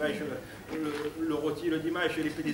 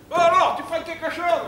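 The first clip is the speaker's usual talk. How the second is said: waiter; 13 semitones higher, 10 dB louder than usual. Retold or imitated. Waiter voiced in stand-up story.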